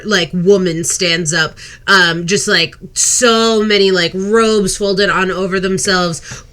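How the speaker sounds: ordinary talk, nothing marked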